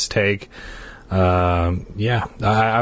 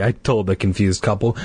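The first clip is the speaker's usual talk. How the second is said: ordinary delivery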